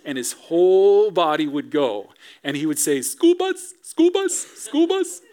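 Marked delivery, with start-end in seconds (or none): 3.0-5.2 put-on much higher, chanting toddler voice; excited toddler. Father imitates son Reese, excited toddler chant. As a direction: chant it like a thrilled two-year-old. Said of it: "He couldn't pronounce his S's".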